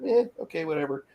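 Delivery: high-pitched, dismissive "eh" settling into indifference; mock dismissive. Voices indifference to a soft cry.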